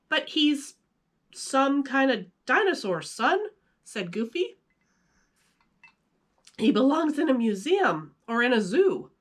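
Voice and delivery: high, goofy dad voice, lifted above the narration; playing the good-natured cartoon father; Goofy reading his son the facts. Goofy voice in story.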